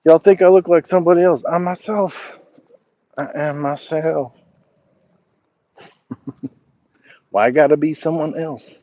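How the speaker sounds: high-pitched playful protest; mock indignation. Mock protest at being compared, then laughs.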